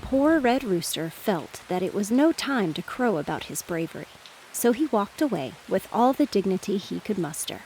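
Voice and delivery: usual speaking voice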